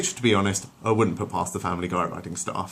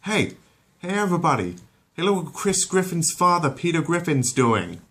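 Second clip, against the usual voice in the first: high, loud hey-everybody call; rally the crowd to look; 10 semitones higher, 4 dB louder than usual. Acted. Peter summons the crowd.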